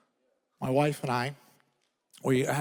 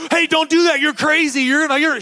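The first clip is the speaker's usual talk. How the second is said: mock panicked grandpa; 10 semitones higher, 4 dB louder than usual. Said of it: "be like".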